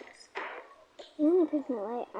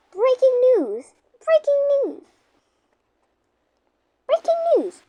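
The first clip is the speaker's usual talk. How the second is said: news announcer; 10 semitones higher, 8 dB louder than usual. Pretend. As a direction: announce it like a TV anchor. Pretending to be the TV news.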